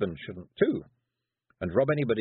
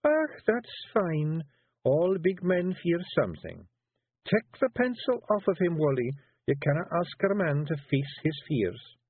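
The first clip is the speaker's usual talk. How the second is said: high Scots teasing, sly; wife goading her husband artfully; 8 semitones higher than usual. A narrator's reading. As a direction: bait him cunningly. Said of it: "said Jeanie artfully".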